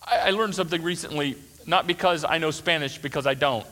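ordinary delivery

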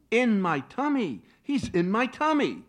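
high, loud delighted cry; joyful amazement. Acted. Wife learns on the phone the boy is in her tummy, high and loud. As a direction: burst out with the happy discovery.

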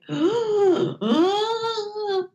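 high, breathy shocked gasp. Real, not acted. Watching the fight scene, a high shocked gasp.

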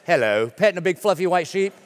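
mock British hello; British accent. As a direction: put on a British accent for a greeting. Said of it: "British accent".

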